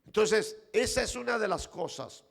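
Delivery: shouting pulpit preacher, rising in fervour; fiery preaching. Real, not acted. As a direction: preach it out to the whole church. Preaching builds.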